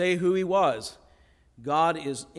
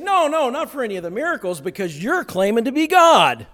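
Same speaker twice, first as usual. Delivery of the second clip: high, loud, fast protest; angry accusers; 7 semitones higher, 10 dB louder than usual. Retold or imitated. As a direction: snap back at him. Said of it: "And they said".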